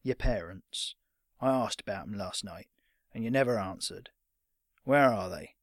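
low, halting veteran's question; rough old fighter, awkwardly asking; serious old fighter. Acted. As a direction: probe gently but gruffly. Old fighter asks about parents.

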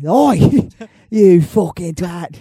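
fail at Australian and land in Cockney, slow; laughing mock Cockney insult; Cockney accent. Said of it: "It always turns Cockney".

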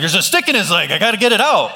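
acted-out defensive pleading; defensive protest. Retold defensive reply to wife.

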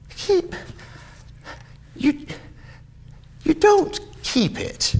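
high, halting disbelief; incredulous nephew. Acted. Nephew disputes that uncle keeps Christmas, incredulous. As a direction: stammer it high in astonishment.

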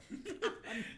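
squeaky high-pitched laugh; weird high laugh. Real, not acted. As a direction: let out a squeaky giggle. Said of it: "me making a weird noise".

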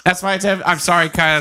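usual speaking voice

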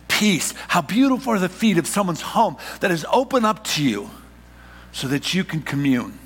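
high, loud, driving sermon delivery; full-force preaching. Acted. Pastor to congregation, preaching at full force.

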